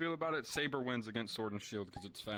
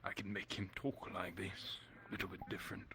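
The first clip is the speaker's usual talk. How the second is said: softer, lightly posh character voice; posh but quiet; 2 semitones lower, 6 dB quieter than usual. Shown as a demonstration. Player offers a quiet posh voice for the villain. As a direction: hush it and make it a bit posh. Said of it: "kind of more posh but quiet".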